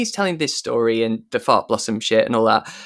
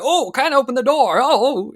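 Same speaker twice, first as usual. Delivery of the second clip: high, flustered slapstick cop; Laurel and Hardy bumbler; 12 semitones higher, 3 dB louder than usual. Retold or imitated. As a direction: fumble at the door like a clown. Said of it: "it's like this Laurel and Hardy moment".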